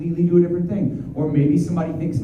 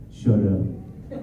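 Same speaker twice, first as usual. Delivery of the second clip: low, softer deadpan answer; Todoroki; 5 semitones lower, 5 dB quieter than usual. Retold or imitated. Hero student answering about his hero name, low deadpan. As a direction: drop it low and quiet, no flourish. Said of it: "he was just like".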